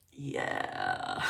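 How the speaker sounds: very low, drawn-out toad croak; toad impression. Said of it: "That's my toad impression".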